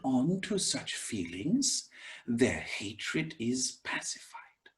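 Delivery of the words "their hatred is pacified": hushed, slow whisper to close the verse. Acted. Teacher whispers the verse's peaceful ending, slowly. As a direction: let the line settle into a whisper.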